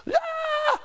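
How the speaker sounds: sudden high group scream; a sharp startled "Ah!" shot up high; band scream. Retold or imitated. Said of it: "The whole band went".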